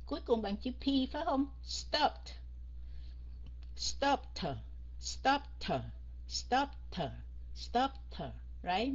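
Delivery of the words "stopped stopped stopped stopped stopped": STOPPED drilled as "stopt", T released. Shown as a demonstration. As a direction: pop a T after the P in Stopped. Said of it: "mình sẽ phát âm thành chữ T". (Stopped, bật rõ âm T cuối)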